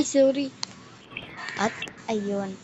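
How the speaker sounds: ordinary delivery, nothing marked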